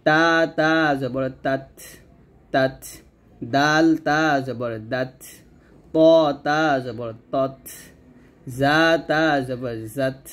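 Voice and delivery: slow spelling, "তাত", "দাত", "তত", "জাত" with puffed final ত; hams drill. Shown as a demonstration. Teacher models tongue letters, slow with breath released ending "দাত". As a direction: stretch letter names, let air out after ত in "তাত". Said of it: "তাকে গিয়ে ধরে হামস করবেন".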